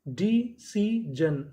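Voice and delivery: डी सी जन in slow separate pieces; syllable by syllable. Shown as a demonstration. Teacher building decision from its codes, syllable by syllable. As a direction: sound out डी, सी, जन with gaps between. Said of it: "तो meaning क्या बनी".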